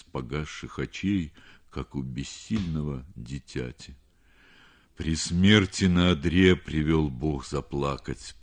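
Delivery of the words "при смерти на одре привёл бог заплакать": ordinary delivery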